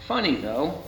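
usual speaking voice

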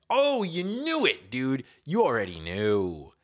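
high, loud, slow knowing groan; exasperated outcry. Real, not acted. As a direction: throw your hands up, you knew it. Exasperated outburst at crying.

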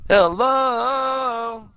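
long, high, held hello; high-pitched hello sustained like a long note; drawn-out call. Retold or imitated. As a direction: call it out high and stretch the last vowel. Acting out recapped show catchphrase.

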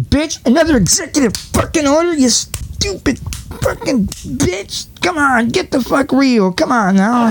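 high, strained mock rant, slowing into a drawn-out insult; mock rant at governor. Vlogger ranting at the governor in a high voice, mock anger.

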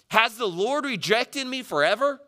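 high, anguished cry to God; acted, high-pitched plea; shouted question. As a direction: fling the question up at heaven. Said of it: "You ever shout that at God?".

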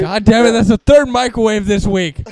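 hammed-up exasperated parent; mock angry dad. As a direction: rant like a fed-up father.

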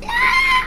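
shrill enemy scream imitation; high mock battle cry. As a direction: let out a silly high attack yell. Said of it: "they just go".

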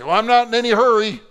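high, loud, quick mock protest; voicing a listener. Pastor voicing a congregant's reply, high and loud mock protest. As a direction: play the nervous listener brushing it off. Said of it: "You say".

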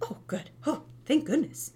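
high hammed-up relief; mock relief. Mom jokes with child after fall, hammed-up relief. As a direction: pretend to be hugely relieved. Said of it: "And I'm like".

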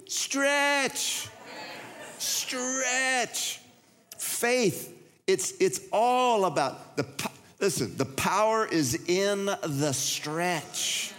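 high, slow, stretched preacher climax; preaching at full force. Acted. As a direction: drive the point home to the church.

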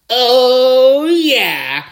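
high, loud "Oh"; excited whoop. Real, not acted. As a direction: shout the celebration. Signature celebration after a pick.

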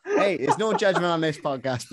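slow, laughing tease. Real, not acted. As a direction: chuckle as you reassure. Teasing while laughing.

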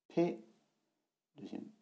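ordinary talk, nothing marked

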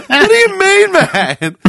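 high, incredulous, through laughter; laughing disbelief. Retold or imitated. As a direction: protest while cracking up.

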